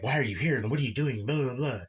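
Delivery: mildly worked-up imitation of an upset mom; mock freak-out, only half-hearted. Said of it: "she's freaking out on him".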